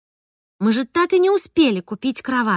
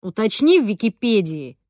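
tossed-off jab. A narrator's reading. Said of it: "бросил ему неожиданно Виталик".

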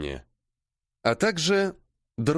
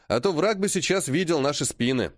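ordinary delivery, nothing marked

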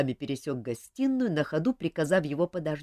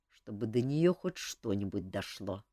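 grumbling; 6 semitones lower than usual. Acted. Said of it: "сердито проворчал".

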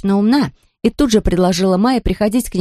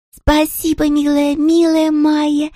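happy whisper; 9 semitones higher, slower than usual. Acted. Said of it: "прошептала счастливая Лиси Лотта".

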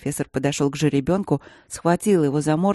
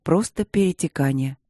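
plain reading voice

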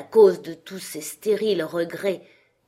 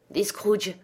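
plain reading voice

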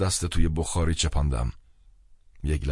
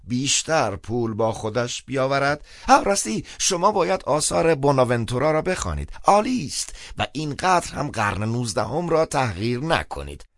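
high-pitched, slightly raised priest voice; speaking up; 11 semitones higher, 3 dB louder than usual. A narrator's reading. Priest notes down the caller's message, speaking up. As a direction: pipe up in a high, lively voice. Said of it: "سپس با صدای بلند گفت".

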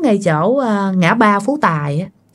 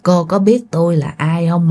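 low, ominous rumble; rumbling man's voice; 4 semitones lower than usual. Acted. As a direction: play a mysterious driver with a lowered, resonant voice. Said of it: "cái giọng của ổng rền, rền, rền, rền". (giọng đàn ông trầm rền, bí hiểm)

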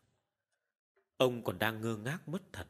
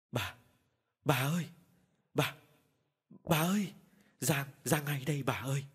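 stammering in fright. A narrator's reading. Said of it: "miệng kêu ú ớ".

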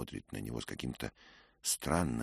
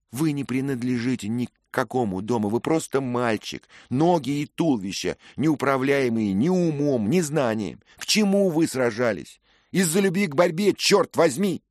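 higher, louder scolding; scornful young lady; 13 semitones higher, 12 dB louder than usual. A narrator's reading. Lady scolding Dick for fighting without reason, scornful. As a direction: tear into the boy with contempt.

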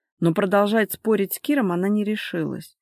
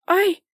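high, loud, short yelp; cry of pain; 12 semitones higher, 5 dB louder than usual. A narrator's reading. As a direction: cry out sharply as if hurt. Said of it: "вскрикнула она". (вскрикнуть от боли, высоко)

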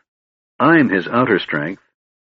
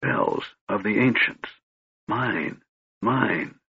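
much softer, fading words; a dying mage whispering; faint whisper; 6 dB quieter, slower than usual. A narrator's reading. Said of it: "Raistlin whispered".

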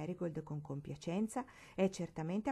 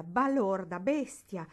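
louder, venomous name-calling; venomous insults; 7 dB louder, slower than usual. Acted. Barbara still raging at Marigold, venomous louder insults. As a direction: throw the insults with poison. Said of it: "Che veleno tentava di mettere".

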